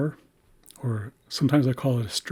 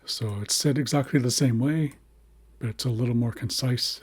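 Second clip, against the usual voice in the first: usual speaking voice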